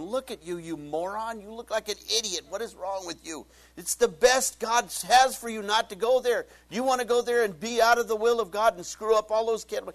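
loud, high, hammed-up rant; mock tearful self-scolding. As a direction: berate yourself in an escalating acted tantrum. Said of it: "I'm sitting there crying".

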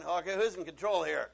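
loud, demanding soldier; storming soldiers shouting; shouted question. Acted. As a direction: bark it at the room.

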